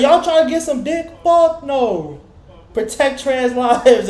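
high, hammed-up heckling; mock ranting naked guy. Naked guy heckling the Pride crowd, high mock rant. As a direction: keep the naked guy's high rant going.

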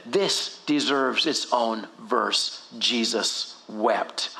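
low, very slow and weighty; solemn reverence. Acted. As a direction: give every word its own space. Reverent reading of the short verse.

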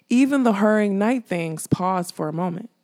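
ordinary delivery, nothing marked

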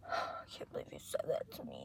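gasped, whispered mock hurt; mock offended. Friend mocking him when joked back at, offended whisper. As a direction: whisper it as if deeply wounded. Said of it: "he gets so offended".

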